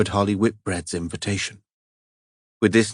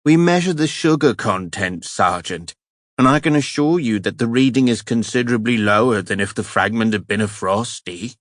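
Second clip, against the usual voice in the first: hammed-up cultured English accent, pitched up; mock cultured accent; 3 semitones higher than usual. Phelps mocking the forensics woman in cultured English accent. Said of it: "Phelps adopted a cultured accent".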